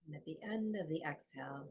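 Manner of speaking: soft, unhurried meditation voice with long pauses; guided meditation. Acted. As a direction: guide them gently into the breath.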